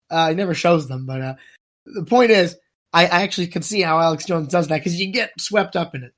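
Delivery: ordinary talk, nothing marked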